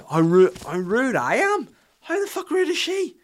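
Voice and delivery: incredulous, squawking indignation; indignant retort. Retold or imitated. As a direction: throw the accusation back, voice leaping up. Acted indignant retort to his wife.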